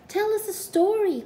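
put-on high, eager child's plea; begging. A narrator's reading. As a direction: beg like an excited little kid. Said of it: "Cuddlepie begged".

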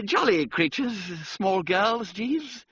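high, loud jovial toff; strained cheery gentleman; rueful young gentleman. Acted. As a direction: put a brave face on it. Wooster remarks after pause.

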